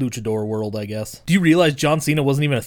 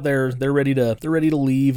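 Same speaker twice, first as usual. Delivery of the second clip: usual speaking voice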